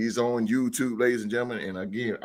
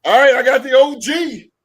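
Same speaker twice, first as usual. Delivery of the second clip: high loud hype intro; hype announcer call; 10 semitones higher, 10 dB louder than usual. Real, not acted. Host introducing the OG guest, loud hype call. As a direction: announce him like a ring introduction.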